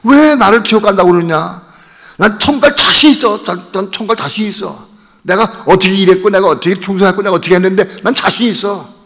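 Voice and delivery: acted outrage, high and loud; indignant protest. Mother defending her faith to her son, indignant protest.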